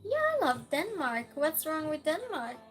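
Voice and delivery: usual speaking voice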